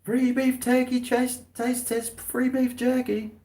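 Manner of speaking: high sing-song sales call; jerky vendor call. Retold or imitated. Stall seller calling passers-by to taste jerky, high sing-song. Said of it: "they were always just like".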